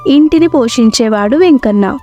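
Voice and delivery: plain reading voice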